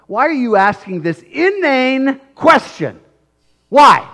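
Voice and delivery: rising to a loud, slow demand; Jairus exasperated. Acted. Jairus confronting Jesus, building to a loud why. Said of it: "Jairus is asking the question".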